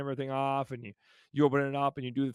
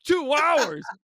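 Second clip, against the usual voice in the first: mock outrage; 11 semitones higher, 10 dB louder than usual. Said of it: "someone's gonna say in the comment section".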